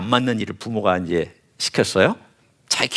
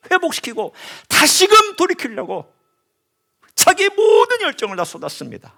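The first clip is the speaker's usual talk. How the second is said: fiery preaching; 11 semitones higher than usual. Acted. Preacher extolling King Josiah's zeal to the congregation, impassioned. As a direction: preach it with rising fervor.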